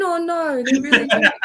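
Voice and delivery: high-pitched, dismayed wail at the discovery; mock disbelief. Recalling to the host when wrestling turned out fake, in mock disbelief. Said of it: "I couldn't believe it".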